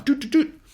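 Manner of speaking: high sung-out dialing beeps; phone dialing beeps. Retold or imitated. Playboy calling up a girl, high imitated beeps. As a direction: imitate keypad tones in a high pitch.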